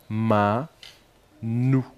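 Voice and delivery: "Ma", long pause, "Nou"; each of "Ma" and "Nou" held on its own; syllable by syllable. Shown as a demonstration. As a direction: sound out "Ma... Nou" for spelling. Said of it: "La syllabe ma".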